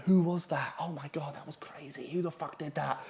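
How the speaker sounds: very soft, half-whispered murmurs; hushed gossip. Retold or imitated. Boarders gossip about the culprit at bedtime, hushed. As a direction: imitate hushed voices wondering who did it.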